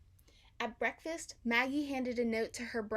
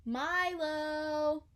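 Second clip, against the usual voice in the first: calling softly; 4 semitones higher than usual. Acted. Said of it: "called Maggie".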